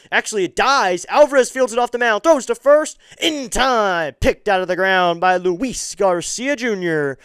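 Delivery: high, loud urgent call of a close play; thrilled baseball commentator at full voice; excited play-by-play. Real, not acted. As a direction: shout the play as it unfolds, peaking on the throw.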